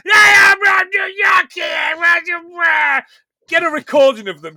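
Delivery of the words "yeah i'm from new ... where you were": much higher, louder, slow mock New York shout; New York accent. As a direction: ham up a New Yorker yelling, slowly. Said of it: "who's like".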